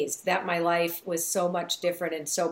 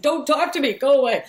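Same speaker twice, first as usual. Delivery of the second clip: loud; 7 semitones higher, 7 dB louder than usual. Retold or imitated. Retelling pushing the voices away.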